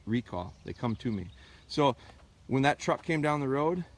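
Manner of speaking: normal talking voice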